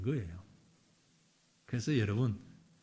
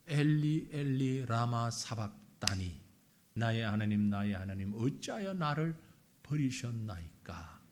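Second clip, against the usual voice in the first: solemn quotation; 9 semitones lower, 6 dB quieter than usual. Retold or imitated. 십자가의 예수님 말씀 인용.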